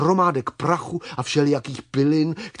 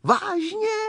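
high-pitched happy surprise; delighted, high questioning squeak. Acted. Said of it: "zaradovali se čmeláci".

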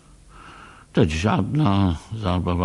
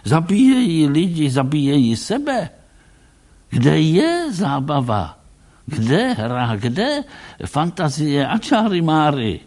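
raised, fast, upset protest; agitated high outcry; 7 semitones higher, 4 dB louder than usual. Acted. Tracy lamenting lost fantasy, high and agitated. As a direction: pour out the grievance, pitch up.

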